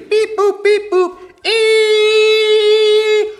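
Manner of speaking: very high, loud squeaky beeps; silly sounds. Acted. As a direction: squeal beeps, then hold a long top note. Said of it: "Make silly sounds like".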